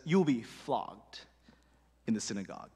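Jesus answering his disciples, grave and low. Retold or imitated. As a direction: drop it low like a heavy verdict. Jesus' grim answer, voiced.

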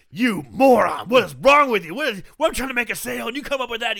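loud, high-pitched mock tirade; angry yelling. Store owner berating his son over a lost sale, yelling angrily. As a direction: chew him out at full volume. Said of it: "start yelling angrily at Pino".

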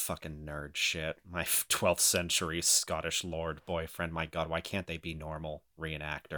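low, soft, slow grumbling; muttered grumble. Retold or imitated. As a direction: grumble it under your breath. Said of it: "they're like".

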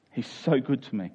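ordinary delivery

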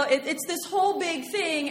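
ordinary delivery

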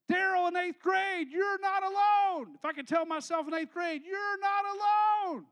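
preaching at full force; impassioned shout. Real, not acted. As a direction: cry it out to your younger self.

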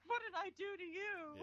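high, crying whine; mock sobbing dog pleading with owner; whining dog voice. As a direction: whimper it like a wronged pet. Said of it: "voicing the dogs too".

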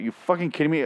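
ordinary talk, nothing marked

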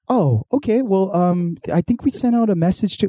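high, sing-song phone rep voice; a lightly hammed-up, eager-to-please rep; customer service rep. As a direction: mimic a chirpy cable company agent. Dad's call with the cable rep.

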